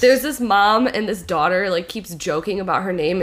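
usual speaking voice

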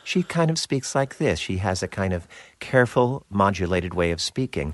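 quiet, measured imitation of mom; careful modulated mom. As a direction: modulate each phrase gently and precisely. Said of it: "modulated voice".

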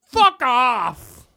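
high, very loud comic shout; hammed-up yelled dismissal; mock outburst. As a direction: hurl the catchphrase across the room. Repeating the show's catchphrase.

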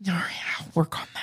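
quick whispered aside; whispered reply. Real, not acted. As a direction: whisper it back sheepishly. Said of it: "your southern accent slips out".

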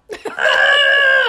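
loud, high-pitched excited squeal; excited scream. Real, not acted. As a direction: shriek out loud, freaking out. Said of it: "I feel like I'm screaming".

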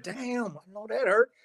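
high mock wince; a high, wincing damn; wincing surprise. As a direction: react as if you felt the hit. Wincing at the blow.

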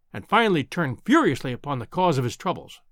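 ordinary delivery, nothing marked

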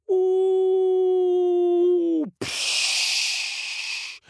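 high falling "ooh", then hushing splash. A narrator's reading. Fish dropped into open sea, whistle and splash noise. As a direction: whistle down, then crash into water.

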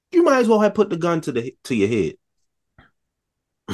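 high-pitched, incredulous scolding; comic outraged disbelief; exasperated rant. Acted. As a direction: pitch it way up, berating a fool. Berating film character for answer.

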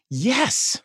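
high-pitched eager little yes; excited girl. Retold or imitated. Young actress answers about being bitten by a dog, excited. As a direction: imitate a kid bursting with recognition. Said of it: "she got so excited".

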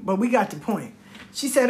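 normal talking voice

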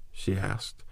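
ordinary delivery, nothing marked

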